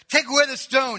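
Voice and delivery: loud, high acted order; commanding. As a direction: call out the order with authority. Voices Jesus giving a command.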